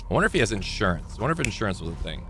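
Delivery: quick soft aside, tossed off fast; fast muttered aside. Real, not acted. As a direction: rattle it off under your breath.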